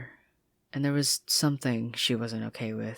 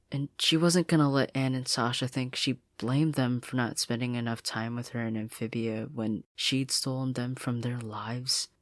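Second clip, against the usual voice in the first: ordinary delivery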